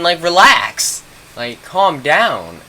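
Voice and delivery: loud, drawn-out retold plea for calm; exasperated calming. Trying to calm panicking friends, exasperated and drawn out. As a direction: stretch each command slowly, pushing the first.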